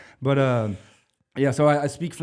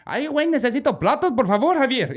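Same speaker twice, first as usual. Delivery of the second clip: higher, louder Spanish kitchen call; busboy shouting for plates in the kitchen; Mexican Spanish; 13 semitones higher, 6 dB louder than usual. Retold or imitated. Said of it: "I was".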